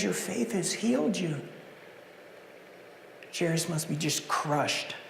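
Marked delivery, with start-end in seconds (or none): 0.0-1.4 soft and loving, well below the usual level; a gentle, loving word to the woman; lovingly. Acted. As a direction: say it like a tender blessing. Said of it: "he lovingly says".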